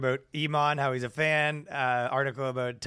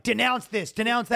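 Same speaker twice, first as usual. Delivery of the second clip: mocking demand; 6 semitones higher, 4 dB louder than usual.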